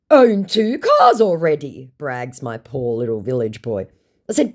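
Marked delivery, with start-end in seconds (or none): 0.1-1.9 high, loud, drawn-out brag; mock boastful scammer. Scammer bragging about cars, mock boastful. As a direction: puff up the boast in a put-on voice.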